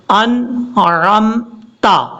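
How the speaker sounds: slow 'انعمتا', split at ع; clear ain. Shown as a demonstration. Teacher presents the word, slow correct recitation. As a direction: squeeze the middle of 'انعمتا'. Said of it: "ٹھیک تلفظ".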